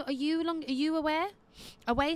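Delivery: ordinary talk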